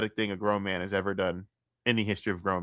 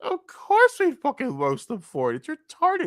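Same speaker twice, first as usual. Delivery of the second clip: high exasperated rant; 13 semitones higher than usual. Real, not acted.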